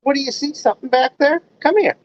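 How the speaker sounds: high-pitched coaxing; high, curious talk to an animal; pet talk. Real, not acted. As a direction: call a pet over in a high voice. Calling a pet over.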